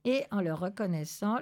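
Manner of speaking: ordinary delivery, nothing marked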